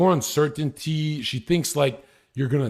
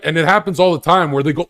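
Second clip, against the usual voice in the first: ordinary delivery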